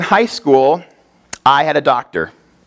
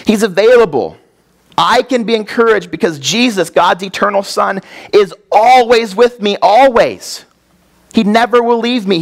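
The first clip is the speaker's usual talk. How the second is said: fervent preaching peak; 6 semitones higher than usual. Real, not acted. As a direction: preach it with full conviction. Preacher peaks in fervor.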